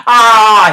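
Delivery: a sharp, loud yell; sudden scream. Retold or imitated. Tarek screaming at Saru to loosen him up, loud scream. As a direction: let out a short scream. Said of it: "Scream back at me".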